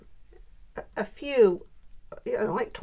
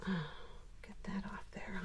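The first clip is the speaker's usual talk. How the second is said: whisper; 14 dB quieter, slower than usual. Real, not acted. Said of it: "muttering to self while gluing".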